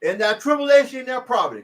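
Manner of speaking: booming scripture read; forceful Bible reading; loud scripture reading. Real, not acted. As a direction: proclaim the verse loudly.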